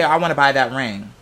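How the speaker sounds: ordinary delivery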